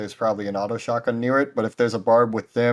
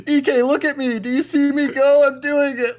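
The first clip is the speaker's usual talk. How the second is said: very high-pitched, sustained excited yell; excited screaming; 5 dB louder than usual. Retold or imitated. Player screaming to teammate mid-round, high excited yell. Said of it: "I was just screaming".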